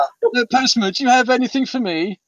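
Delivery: high-pitched imitation of an imperious lady; Hyacinth Bouquet. Sitcom lady questions the poor terrified postman, imitated in high voice. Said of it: "she absolutely terrified the postman".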